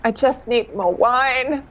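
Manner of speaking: long-suffering, stretched-out aside; mock weary plea. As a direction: draw it out in mock desperation. Own weary reply to pestering guests.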